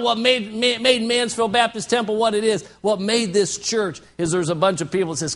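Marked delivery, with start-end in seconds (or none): none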